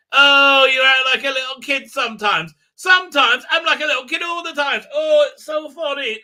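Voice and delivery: high, loud mocking put-on voice; mocking impression. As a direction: send up the character in a shrill squeal of fun. Mocking a character's lines.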